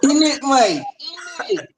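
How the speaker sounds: hammed-up London accent with a laugh; mock London slang. Guest performing his London catchphrase for host. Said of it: "[name] is going, "In it".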